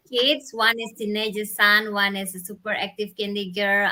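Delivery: ordinary talk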